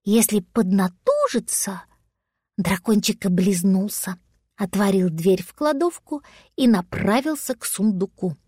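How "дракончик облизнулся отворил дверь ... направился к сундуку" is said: usual speaking voice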